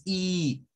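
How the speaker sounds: "I" drawn out as a long ee; held Spanish I. Shown as a demonstration. Teacher shows the wrong vowel, long and sustained. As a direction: hold "I" steady and long. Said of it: "es como sostenido".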